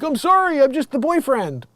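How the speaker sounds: high apologetic whine; meek boyfriend. Retold or imitated. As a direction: plead weakly with the angry passengers. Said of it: "And he's like".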